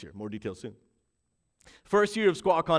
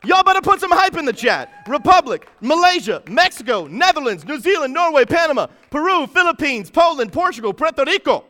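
high, loud preacher's shout; triumphant shouting, pitched high throughout; hyped roll call; 8 semitones higher, 9 dB louder than usual. Real, not acted. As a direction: fire off each name like a rally announcer.

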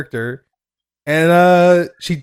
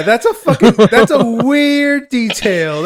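drawn-out, higher-pitched disbelief; loud, lingering incredulity; incredulous; 7 semitones higher, 5 dB louder, slower than usual. Real, not acted. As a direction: stretch it out, high and slow, baffled. Incredulous reaction to show's detail.

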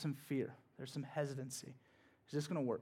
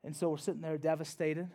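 ordinary talk, nothing marked

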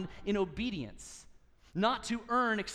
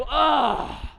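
a big loud exasperated groan; a dad's frustrated disc golf groan; 4 semitones higher, 10 dB louder than usual. Retold or imitated. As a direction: groan out loud after a long throw. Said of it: "and I just go".

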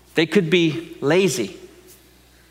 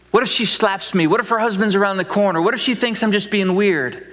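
higher, nervous pleading questions; worried rush of what-ifs; anxious doubting; 4 semitones higher than usual. Pretend. Anxious believer questions God.

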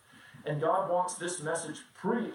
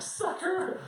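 gloating; 13 semitones higher than usual. Retold or imitated. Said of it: "I'm like".

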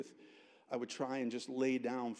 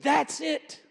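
loud, high-pitched burst; sudden exclamation; 12 dB louder than usual. Retold or imitated. The Lord stopping a student, sudden loud exclamation. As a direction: call it out abruptly, high and strong. Retells the Lord's words again.

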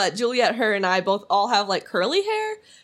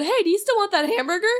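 mock coworker; 10 semitones higher than usual. Coworker asking about hamburger.

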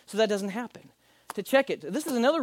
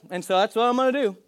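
ordinary talk, nothing marked

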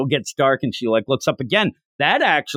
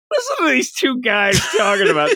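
mocking listener voice; 8 semitones higher than usual. Imitating listeners.

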